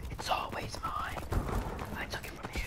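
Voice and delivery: whispered, hushed gloating taunt; creepy whisper. Pretend. Gamer gloating over the captured point, whispered taunt. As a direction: breathe it like a secret threat.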